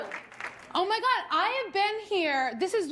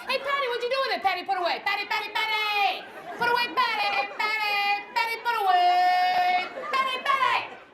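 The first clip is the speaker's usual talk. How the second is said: high, nagging mock holler; hammed-up impatient calling; mad husband calling; 5 semitones higher than usual. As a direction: imitate an angry husband, stretching the name out. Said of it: "He gets mad and starts calling me Patty Put Away".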